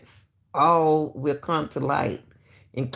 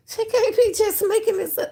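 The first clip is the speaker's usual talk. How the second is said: much higher, incredulous through a laugh; disbelieving, pitched way up; laughing disbelief. Real, not acted. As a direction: squeak it out in amazed disbelief. Laughing disbelief after clip.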